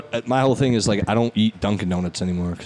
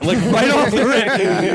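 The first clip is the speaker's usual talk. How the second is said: ordinary talk, nothing marked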